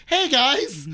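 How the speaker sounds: high, loud cheery call; iPad-on-a-robot greeting. Acted. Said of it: "like, a little robot and just going through".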